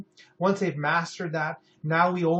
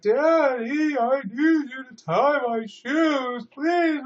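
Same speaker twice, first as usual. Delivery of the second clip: put-on high, loud, drawn-out kid's whine; whining child; 10 semitones higher, 5 dB louder, much slower than usual. Child whines at busy dad to tie shoes, high loud whine. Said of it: "stop whining".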